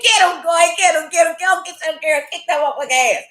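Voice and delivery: high, excited ringside cheering; root for him like a fan at a fight; hyped cheering that settles down. Retold or imitated.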